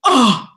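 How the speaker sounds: loud "oh" of recognition; imitated astonished gasp. Imitates his astonished reaction.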